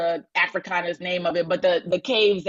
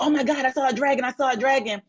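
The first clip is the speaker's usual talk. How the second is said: put-on excited boys; 6 semitones higher, faster than usual. Boys rushing back frightened.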